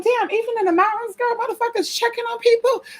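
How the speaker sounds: falsetto disbelief squeal; high squeal. Retold or imitated. Marveling that people check on her in the mountains, high squeal.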